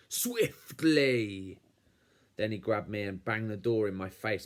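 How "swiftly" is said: drawn-out, insistent villain's order; snarled demand. A narrator's reading. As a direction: stretch out SWIFTLY and push it harder. Uncle repeats demand more forcefully.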